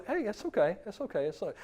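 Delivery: soft, high, soothing reassurance; a dad calming his scared youngest daughter, very softly; soothing a toddler. Retold or imitated. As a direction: hush a frightened little girl gently. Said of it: "I'm gonna go".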